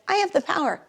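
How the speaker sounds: quick, offhand, not felt; thinking with your head. Shown as a demonstration. Teacher showing the class the empty version, offhand. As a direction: toss it off as a head thought. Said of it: "rather than thinking with your head".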